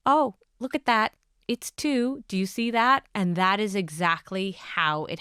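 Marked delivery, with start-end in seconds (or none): 0.1-3.1 bright, higher, louder doctor's delight; doctor to patient at the scan, excited. Retold or imitated. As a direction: show the doctor thrilled at the scan. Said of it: "hear the doctor saying".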